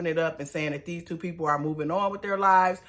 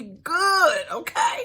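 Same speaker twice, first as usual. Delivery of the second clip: shouted; 10 semitones higher, 5 dB louder, much slower than usual. Real, not acted. Emphatic comic outburst.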